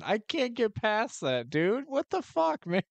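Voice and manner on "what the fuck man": shocked disbelief. Real, not acted. Reacts to shocking game scene.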